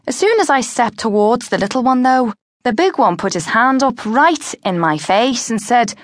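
ordinary delivery

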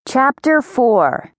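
ordinary delivery, nothing marked

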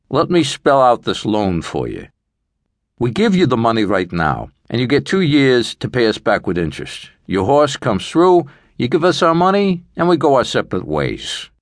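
loan shark laying out terms. A narrator's reading. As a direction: spell out the deal like a loan shark.

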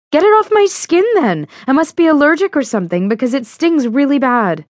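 high, loud frantic plea; near-shriek, desperate to get it off; panicked demand. Acted. Leaf on her skin, panicking.

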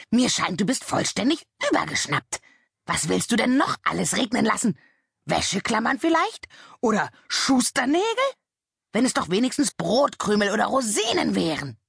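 high, indignant raven scolding; a raven squawking in outrage; shrieking raven. A narrator's reading. Raven berates the little witch, high and indignant. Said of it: "kreischte Abraxas".

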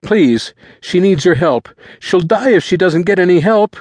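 high, loud, frantic begging; high-pitched, panicked boy; desperate pleading. A narrator's reading. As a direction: beg as if her life depends on it. Boy begs for sister's life.

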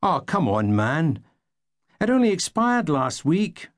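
high, pleading whine. Acted. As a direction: beg him to let it go. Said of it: "looked at him pleading".

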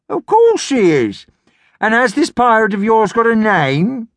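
high indulgent granny; old gran voice. A narrator's reading. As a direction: humour the child like a granny. Gran's voice, humouring.

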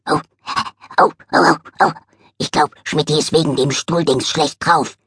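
dog voice, worried about his friend; a scruffy little dog fretting; little dog voice noticing the cat's bad mood; eager little dog voice. Dog character voice.

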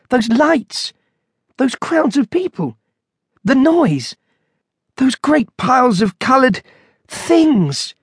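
high-pitched, appalled exclamations; bleak horror. A narrator's reading. Monk overwhelmed by supermarket visions, horrified dismay. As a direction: recoil at each thing you remember, pitched high. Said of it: "he thought bleakly".

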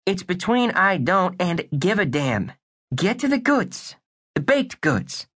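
put-on wisecracking boy detective voice; tough kid detective. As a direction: play it like a pint-sized noir detective.

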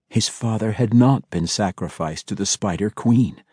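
ordinary delivery, nothing marked

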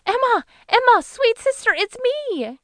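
put-on very high, squealing girlish cry; excited high girl. A narrator's reading. Girl hugging her bewildered sister Emma, high excited squeal. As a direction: squeal it out to a long-lost sister.

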